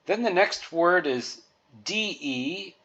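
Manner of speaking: ordinary delivery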